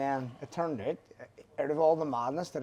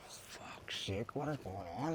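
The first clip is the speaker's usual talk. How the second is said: exasperated whisper; 3 semitones lower, 10 dB quieter, slower than usual. Retold or imitated. Retold reaction to news frenzy.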